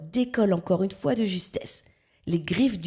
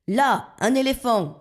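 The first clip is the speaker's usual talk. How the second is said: higher excited shout-out; exclaiming; 6 semitones higher, 3 dB louder than usual. A narrator's reading. Blackbird spots an elephant for his passengers, exclaiming. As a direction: point it out with glee. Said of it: "s'exclame Zigomar".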